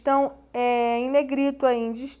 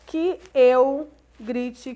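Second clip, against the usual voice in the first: slow model reading; 4 dB louder, much slower than usual. Shown as a demonstration. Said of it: "o futuro vai expressar".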